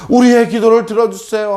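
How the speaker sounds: pleading prayer. Acted. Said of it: "하면서 아침부터 낮까지 기도했었어요". (높인 목소리로 계속 기도하듯)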